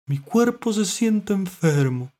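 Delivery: high, loud, drawn-out complaint; ailing mollusc. A narrator's reading. Mollusc describing sickness, slow high lament. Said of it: "dijo Mitilo".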